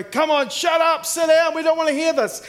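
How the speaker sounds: loud, high jeering heckle; heckler shout. Retold or imitated. Student heckling the street preacher, loud jeering shout. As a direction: shout him down like an angry heckler. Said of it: "I want you to go".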